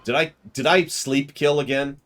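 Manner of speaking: hammed-up alarm; mock horror. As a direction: gasp at the bodies. Wakes to find everyone dead.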